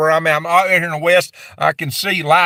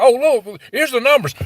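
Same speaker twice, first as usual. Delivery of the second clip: higher, mock eager voice; mocking gullible friend; 6 semitones higher than usual. Mimicking a friend who fell for the numbers, mock eager. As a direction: wave the numbers like a fooled friend.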